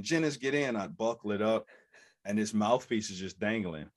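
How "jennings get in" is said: ordinary talk, nothing marked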